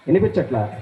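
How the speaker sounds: low-pitched puppet retort; low mock-innocent question; puppet's voice. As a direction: answer low, as the cheeky puppet. Puppet claims dogs can be heard.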